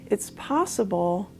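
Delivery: soft, unhurried body-scan voice with long pauses; guided meditation. Real, not acted. Leading listeners through a body scan from toes to legs, guided meditation. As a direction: gently guide them through the body, calm and quiet.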